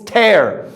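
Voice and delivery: drawn-out "tear" rhyming with air; verb pronunciation of tear. Shown as a demonstration. Teacher introducing the ripping word, "tear" said slowly as tair. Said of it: "As a verb".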